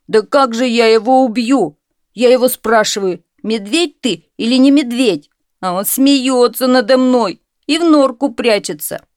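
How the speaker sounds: loud, high complaint; indignant son. A narrator's reading. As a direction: protest to the father.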